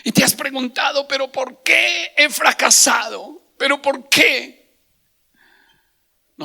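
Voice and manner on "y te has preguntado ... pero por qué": loud, high, drawn-out anguished questioning; full-force preaching. Real, not acted. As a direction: preach it as a cry of self-questioning. (prédica a toda voz, aguda y angustiada)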